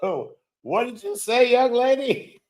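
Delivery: put-on high, teasing scold of a young lady; mock disapproving elder voice.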